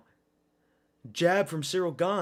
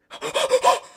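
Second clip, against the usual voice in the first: a high, sudden gasp of shock; sharp gasp; 8 dB louder than usual. Real, not acted. Streamer gasping loudly as the takedown comes. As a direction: suck in air at the takedown.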